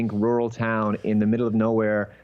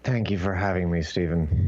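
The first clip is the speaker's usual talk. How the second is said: lower, put-on creepy voice; lower, mock professional speaking voice; 7 semitones lower than usual. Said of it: "why'd you just put on that creepy voice?".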